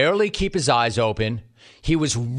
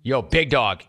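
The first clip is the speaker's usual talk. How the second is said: usual speaking voice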